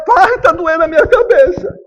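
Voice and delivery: put-on child's complaint. Sick boy tells father.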